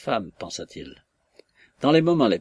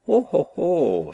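mock dismay; 6 semitones higher, 4 dB louder, slower than usual. Mocking outburst at the tutor.

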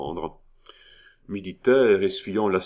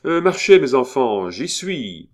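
raised, louder call to the group; 5 semitones higher, 7 dB louder than usual. A narrator's reading. Monsieur Coeur urges his men on, out loud. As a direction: speak up so everyone hears, starting high and strong. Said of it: "reprit à voix haute".

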